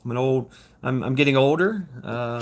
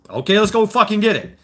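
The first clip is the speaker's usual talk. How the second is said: fired-up charge; 8 semitones higher, 5 dB louder than usual. Retold or imitated. Voicing fired-up Marines.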